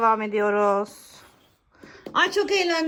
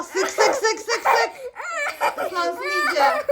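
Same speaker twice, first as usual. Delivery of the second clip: laughing excitedly; 9 semitones higher than usual. Real, not acted. Squirting during the game penalty.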